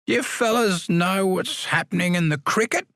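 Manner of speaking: lightly voiced high, quavery old man; a hint of a frail old man asking; high quavering old man. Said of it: "in his high, quavering voice".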